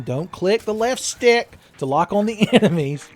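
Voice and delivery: high, loud, indignant complaint; exasperated rant. Real, not acted. Player fighting the lock-on control, exasperated outburst. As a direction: raise the voice in mounting exasperation.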